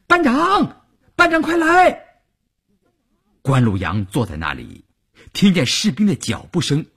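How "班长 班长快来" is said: very high, urgent shout for help; shouting on the run. A narrator's reading. Soldier running for the stairs calls his squad leader, shouting. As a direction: yell out to summon your superior at once. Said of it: "一边跑一边大喊".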